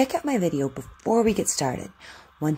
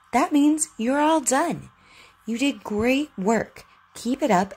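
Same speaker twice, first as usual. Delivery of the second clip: warm praise; 6 semitones higher than usual. Real, not acted. Praising child at lesson end.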